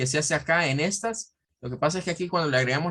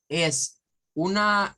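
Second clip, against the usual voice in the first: usual speaking voice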